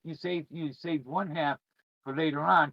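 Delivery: ordinary delivery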